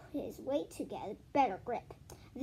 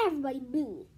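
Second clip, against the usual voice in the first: ordinary delivery, nothing marked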